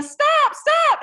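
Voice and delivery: high, loud cries of stop; retold yelling. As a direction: shout stop from the slope like a panicked instructor. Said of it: "they were yelling".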